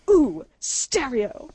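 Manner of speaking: slow drawn-out sing-song "Ooh, stereo"; ooh stereo girl. Retold or imitated. A girl on the bus teasing two people who spoke together, slow and drawn out. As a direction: stretch it out like a girl calling from the back. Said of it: "This girl pops up from the back and goes".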